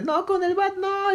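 very high, slow, strained pleading; pleading kid. Retold or imitated. Neighbor kid begging his dad, high pleading voice. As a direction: beg like a kid about to be hit.

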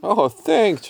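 high, pleased cartoon voice; grateful in a squeaky register; Randy the cat. A narrator's reading. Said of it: "said Randy".

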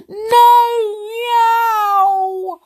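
loud, very high cat wail; wailing kitten. Acted. Terrified kitten stuck up the chimney, wailing. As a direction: wail it out as a long yowl. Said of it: "wailed poor Tiddles".

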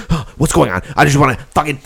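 exasperated, hammed-up confusion; acted frustration. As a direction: play someone getting worked up. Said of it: "you get fucking frustrated".

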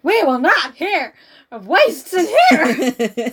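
hammed-up stern judge through a laugh; mock judge outburst. Friend mocking the judge banning talk of waists, a laughing mock bellow.

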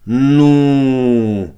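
offended, drawn-out Odessa "ну"; a long sulky Odessa-style "ну"; Odessa intonation. Acted. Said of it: "обиженно усмехнулась она с непередаваемой, неподражаемой одесской интонацией". (обиженное одесское «ну» с усмешкой)

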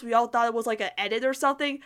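ordinary delivery

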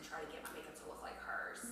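raspy, voice mostly gone. Real, not acted. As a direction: speak with a worn-out whisper. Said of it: "I sound like I have no voice".

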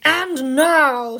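big, loud storybook announcement; acted ceremonious voice; Father Christmas. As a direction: proclaim it with slow grandeur. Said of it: "said Father Christmas".

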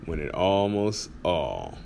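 slow, soft title announcement that fades away; announcing the title. Shown as a demonstration. Host announcing the episode title, slow and hushed. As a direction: present the title like a quiet tagline. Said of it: "Episode number three forty-six underway".

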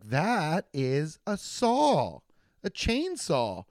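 slow, patient spelling out; slow explaining. Acted. Store clerk explaining a chainsaw to an old man, slow. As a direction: explain it slowly like to a child.

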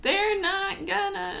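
high, slow mock Southern accent. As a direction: stretch it out in a put-on southern voice. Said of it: "Why did I turn southern?".

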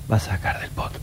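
whispered commentary. Retold or imitated. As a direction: hush it so players can't hear. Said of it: "porque no se podía hablar".

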